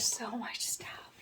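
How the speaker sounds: hushed, slow near-whisper; awed whisper. Real, not acted. Vlogger marvelling at a box full of gifts, awed whisper. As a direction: breathe it out slowly in quiet amazement.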